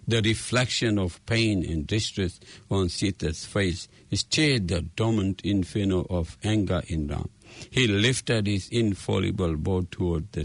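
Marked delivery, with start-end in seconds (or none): none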